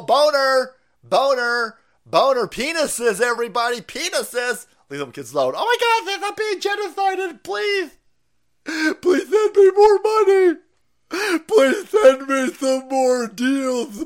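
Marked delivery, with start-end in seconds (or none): 0.0-4.7 mock shouting. Mock shouting at young people. 5.6-14.0 high, loud, hammed-up pleading; mock whiny activist.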